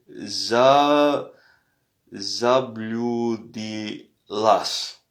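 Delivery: zabludillas drawn out very slowly; very slow demo. Shown as a demonstration. Teacher sounding out the feminin form very slowly. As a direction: stretch every syllable of zabludillas. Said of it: "Voilà, vous avez la prononciation".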